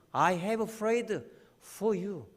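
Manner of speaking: drawn out gently. Acted. Jesus speaking to Peter, slow and gentle. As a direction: linger on it tenderly. Jesus' words quoted softly.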